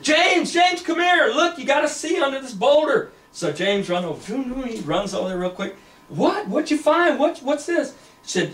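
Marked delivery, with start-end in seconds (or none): 0.0-3.1 urgent calling out. Acted. Woman summoning James to look under the boulder, urgent shout. Calls companion over to see find. 6.1-7.9 high-pitched, urgent questions; eager questioning. Acted. James running over to see the find, excited questioning.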